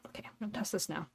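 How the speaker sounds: whispered aside; whispering. Real, not acted. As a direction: whisper it to yourself. Quiet aside before tasting.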